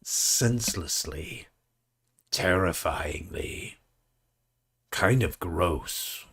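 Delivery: slow, low narrator voice; slow dramatic commentary; mock narration. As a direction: draw out each word like a grave narrator. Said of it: "You said narrate".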